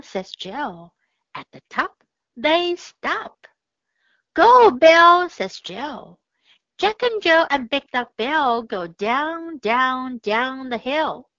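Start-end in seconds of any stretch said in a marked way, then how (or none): 4.3-5.3 loud, high call to the dog; urging shout. Acted. Jill urges the dog to go, loud and high.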